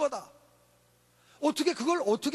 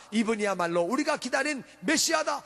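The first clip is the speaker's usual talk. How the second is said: usual speaking voice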